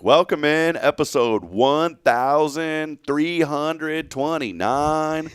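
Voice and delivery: high, drawn-out announcer intro; show-intro announcing. Pretend. Host imagines announcing a far-off milestone episode, drawn-out announcer voice. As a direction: stretch each number big and high, announcer-style. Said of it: "what I'm gonna say is".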